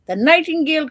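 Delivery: high, loud excited call; excited cry. A narrator's reading. Servants finding the bird, an excited cry. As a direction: call it out like you found treasure. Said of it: "cried the servants".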